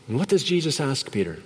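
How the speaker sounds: ordinary talk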